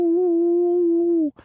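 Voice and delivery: high, loud imitated howl from the dark moor; werewolf howl. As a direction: howl out like a wolf in the distance. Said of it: "and then they hear".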